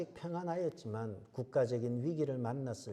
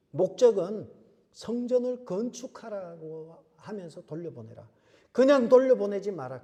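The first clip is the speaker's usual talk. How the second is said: loud, raised-pitch proclamation of a command; forceful preaching; 7 semitones higher, 12 dB louder than usual. Acted. Preacher quotes God's command to send Israel back, forceful proclamation. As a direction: declaim it powerfully to a congregation.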